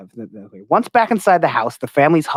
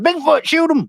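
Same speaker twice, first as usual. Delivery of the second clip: mock shout; 12 semitones higher than usual. Mocking trigger-happy people.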